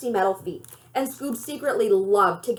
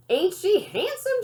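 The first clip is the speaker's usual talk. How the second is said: proud grandma; 8 semitones higher than usual. Acted. Grandma boasting about her RV.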